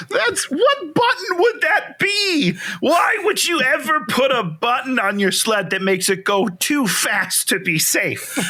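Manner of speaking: half-laughing shout of disbelief; incredulous outburst. Real, not acted.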